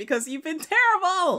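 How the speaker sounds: high, loud mock outrage; hammed-up indignant telling-off; indignant clapback. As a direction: fire back at full pitch, exasperated. Acted answer to girlfriend's complaint.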